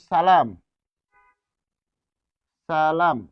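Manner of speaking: সালাম with a sharp dental s; dental s demo. Shown as a demonstration. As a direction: stress the s of সালাম. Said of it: "এটা যেন স". (সালাম দন্ত স উচ্চারণে)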